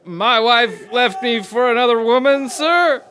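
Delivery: high, loud barked answer; recruit shout. Acted. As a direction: snap it out to the sergeant.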